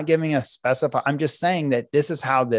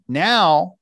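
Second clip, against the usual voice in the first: ordinary delivery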